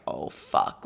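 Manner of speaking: whispered dismayed curse; whispered shock. Retold or imitated. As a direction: breathe it out in shock. Parents' shocked reaction voiced.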